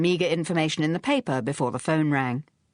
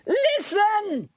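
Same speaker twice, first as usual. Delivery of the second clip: yell. Acted. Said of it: "yelled Phryne".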